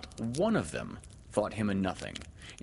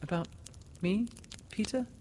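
high, halting little question; faltering; 12 semitones higher, 4 dB quieter than usual. A narrator's reading. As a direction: ask high, with hesitant breaks. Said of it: "she said falteringly".

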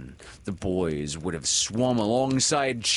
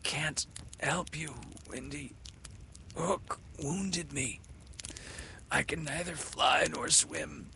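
weak whisper; 8 semitones higher, 6 dB quieter than usual. A narrator's reading. Wounded Peter confessing.

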